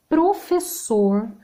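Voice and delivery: Professor stretched slowly with a firm SS; slow demo word. Shown as a demonstration. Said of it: "todos com dois S's". (Professor dito devagar)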